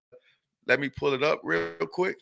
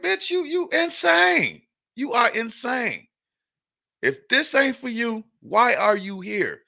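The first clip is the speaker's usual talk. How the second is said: incredulous retort; 7 semitones higher than usual. Retold or imitated. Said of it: "I'm looking at her like".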